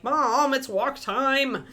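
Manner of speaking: high, drawn-out mock whine; whiny pestering-pet impression; annoyed dog. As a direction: imitate a dog nagging its owner, stretching the words. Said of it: "Kiki will be very annoyed because she'll be like".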